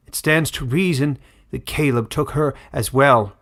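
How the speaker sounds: put-on old elder denouncing the fugitive, loud and indignant; rally the crowd against the vandal; loud accusing elder. Elder condemning Caleb before the crowd, loud and indignant.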